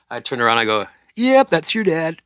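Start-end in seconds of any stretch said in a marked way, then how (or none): 1.2-2.2 proud boast. Retold or imitated. Dad boasting to son.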